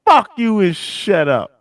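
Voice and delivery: excitedly imitating a defiant comeback; gleeful retelling. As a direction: crow the punchline with relish. Gleefully repeats the clip's punchline.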